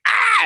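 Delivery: short, high, loud yelp; mock birth scream; imitated scream.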